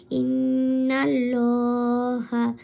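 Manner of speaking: ইন্নাল্লাহা with the lam said full and round; ইন্নাল্লাহা, heavy rounded lam, drawn out; full heavy Allah. Shown as a demonstration. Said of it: "এভাবে পড়তে হবে".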